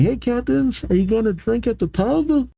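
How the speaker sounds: lightly voiced eager kid, high and soft; Cullen. Kid running out to the captains, high eager voice. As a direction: pitch it up like a child. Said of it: "Cullen comes running out saying".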